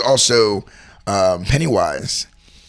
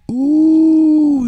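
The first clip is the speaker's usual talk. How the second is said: cringing ooh; 6 dB louder than usual. Real, not acted. Awkward pregnant question story.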